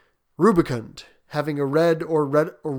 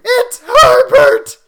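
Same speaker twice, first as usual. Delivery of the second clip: drawn-out, very high scream; frantic mother's shriek, stretched out; 15 dB louder, much slower than usual. A narrator's reading. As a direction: scream it slowly at the top of the voice. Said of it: "she screamed".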